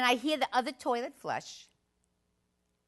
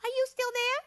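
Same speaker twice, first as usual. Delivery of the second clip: put-on high, louder small-kid question; little child's voice; 5 dB louder than usual. Child calling to the stranger in the next stall, high and curious. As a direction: call out like a curious four-year-old. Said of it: "The voice says again".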